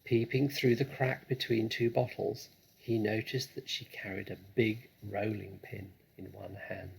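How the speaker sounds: ordinary delivery, nothing marked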